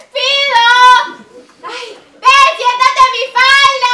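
loud high shouting; calling out. Acted. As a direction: holler for the dog. Stoned woman calls the dog.

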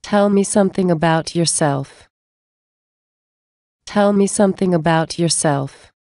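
ordinary delivery

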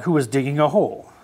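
usual speaking voice

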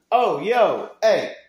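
excited call. Real, not acted. Excited about new lights.

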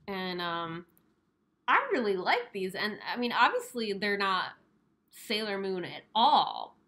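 muffled behind mask; speaking with mouth covered; through a face mask. Real, not acted. Seen 0: Pleated face mask covers mouth and nose throughout the labelled words.

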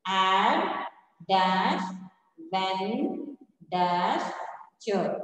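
"Add", "when", "cher" set apart by pauses; syllable by syllable. Shown as a demonstration. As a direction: split the word into "Add", "when", "cher", saying "dash" between. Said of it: "we have to split it like this".

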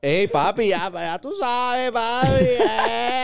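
put-on Puerto Rican street accent. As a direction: stretch it out like a cocky teen from the metro area. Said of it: "imitar el acento de la calle".

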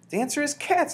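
very high, loud announcement; high-pitched excited yell; shouted. A narrator's reading. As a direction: shout the big idea. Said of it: "I shouted".

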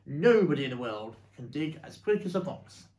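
loud, emphatic boast; shouted. Acted. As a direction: declare it loudly while starting to dig. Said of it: "shouted Mister Fox".

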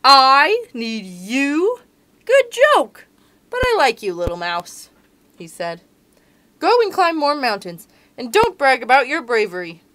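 loud amused lion, booming; lion mocking the little mouse; laughing lion. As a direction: boom it out as a tickled lion. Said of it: "Lion laughed and laughed".

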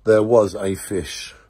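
deep mock-epic fish presentation; mock dramatic announcer. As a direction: present the fish like a grand movie monster. Mock Jaws tribute introduction.

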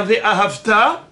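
"Ve'ahavta" with weight on final "ta"; "Ve'ahavta" as ve-ahav-TAA; stress at the end. Shown as a demonstration. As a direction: push through to the last syllable of "Ve'ahavta". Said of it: "c'est à la fin du mot".

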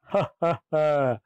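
short run of villainous ha-has; theatrical monster laugh; demon laugh. A narrator's reading. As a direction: laugh in measured, menacing beats. The demon laughs before his chant.

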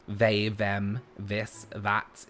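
"they, them, this, that" with V in place of TH; th-fronting. Shown as a demonstration. Streamer explaining southern accent, V for TH in "they, them, this, that". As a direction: start "they", "them", "this", "that" on a V sound. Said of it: "we replace it with, um, Fs and Vs".

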